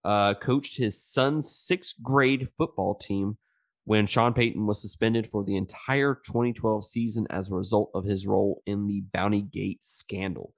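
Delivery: ordinary talk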